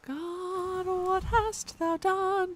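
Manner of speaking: mock lament. Streamer repeating the character's despair, high and slow. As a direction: wail it like a doomed tragedian. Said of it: "got him a little bit excited".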